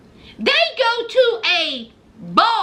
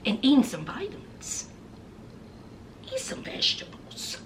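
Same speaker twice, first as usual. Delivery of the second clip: whisper; 5 semitones lower, 11 dB quieter, faster than usual. Real, not acted. Whispered advice aside.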